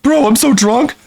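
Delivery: mock a teen on his first beer; drunk teen mockery. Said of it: "Fifteen-year-old's first beer".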